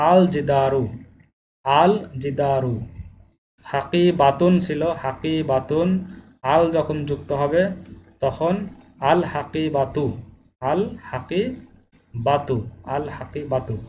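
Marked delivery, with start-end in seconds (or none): 1.7-2.7 আল জিদারু said slowly, লাম crisp before জিদারু; ধীরে আলাদা লাম সহ আল জিদারু; clear separate lam. Shown as a demonstration. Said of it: "হরফটি স্পষ্ট এবং আলাদা করে উচ্চারণ করতে হবে". 10.6-12.6 আল হাকিবাতু stretched very slowly, লাম distinct; drawn-out model of আল হাকিবাতু; খুব ধীরে স্পষ্ট লাম সহ আল হাকিবাতু; clear separate lam. Shown as a demonstration. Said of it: "হরফটি স্পষ্ট এবং আলাদা করে উচ্চারণ করতে হবে".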